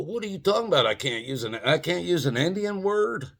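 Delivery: hammed-up innocent protest; mock innocent indignation. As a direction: play dumb and offended. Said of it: "I go".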